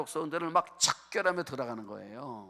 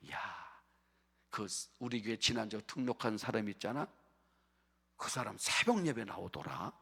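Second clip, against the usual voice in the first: low, soft murmur of amazement; hushed gossip; 7 semitones lower, 7 dB quieter than usual. Acted. Church members talk about a newcomer at dawn prayer, hushed gossip. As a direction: pass it on quietly to a fellow member.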